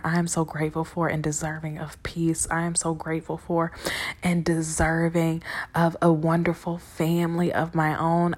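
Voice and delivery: hushed, intimate recital of blessings; whispered affirmations. Shown as a demonstration. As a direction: whisper each affirmation softly.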